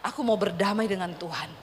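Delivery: a hint of weeping surrender; mild tearful prayer. Widow praying alone in her room, soft tearful surrender. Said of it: "dia nangis di kamar".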